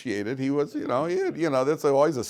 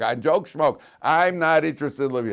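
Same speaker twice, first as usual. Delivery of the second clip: loud, higher scornful retort; retold angry dismissal; 5 semitones higher, 7 dB louder than usual. Wife throws out her husband's joke excuse, loudly indignant. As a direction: snap back loudly, waving the excuse away.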